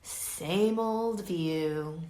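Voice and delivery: usual speaking voice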